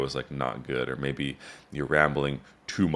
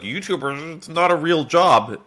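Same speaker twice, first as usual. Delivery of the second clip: high, slow scoffing imitation of doubters; mocking sceptics; 13 semitones higher, 6 dB louder, slower than usual. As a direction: sneer it out like a know-it-all who looks down on YouTubers. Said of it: "People are like".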